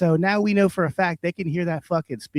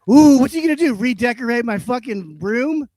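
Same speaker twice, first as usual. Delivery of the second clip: much higher, louder mocking "ooh"; mock fear; 7 semitones higher, 6 dB louder than usual. Mocking the HOA head's threats, mock-scared taunt. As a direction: sneer in fake fright.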